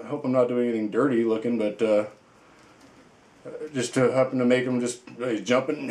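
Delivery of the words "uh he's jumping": ordinary talk, nothing marked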